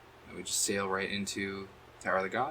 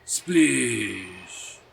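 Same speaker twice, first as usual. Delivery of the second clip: drawn-out falling sound-effect voice; put-on splash, sliding down; mock splash cry; 9 dB louder than usual. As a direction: stretch it out like a cartoon splash. Mimicking game's splash cry.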